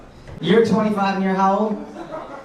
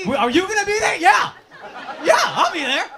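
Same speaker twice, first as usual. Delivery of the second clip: mock excited partygoer; 9 semitones higher than usual. Mock party pitch dialogue.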